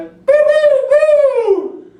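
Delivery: high robot beeping noise; robot beeps. Pretend. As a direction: beep high like a toy robot. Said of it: "I am a robot".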